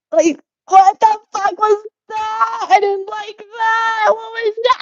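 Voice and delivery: very high, drawn-out embarrassed wail; high-pitched squeal. Real, not acted. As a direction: squeal at your own odd delivery. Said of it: "Why did I say it like that".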